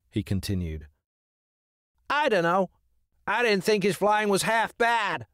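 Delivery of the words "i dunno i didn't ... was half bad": high, bouncy bird voice; hyper crow. Acted. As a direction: chatter like an excited crow. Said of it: "said the hyper crow".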